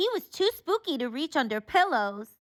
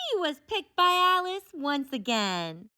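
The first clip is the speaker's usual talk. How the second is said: usual speaking voice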